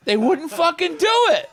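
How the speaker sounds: loud, high-pitched exclamation; exasperated shout. Real, not acted. Comic telling cohost the band refused, exasperated shout. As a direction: shout the punchline in outraged disbelief. Comic outburst as punchline, real retelling.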